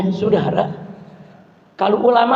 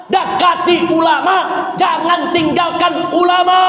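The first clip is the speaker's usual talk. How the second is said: raised, slow exhortation; slow preaching shout; 6 semitones higher, slower than usual. Real, not acted. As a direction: call them back to the scholars, slowly.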